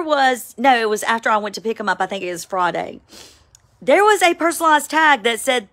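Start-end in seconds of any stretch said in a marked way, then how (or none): none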